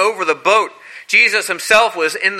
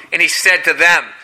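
normal talking voice

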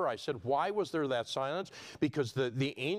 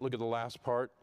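ordinary talk

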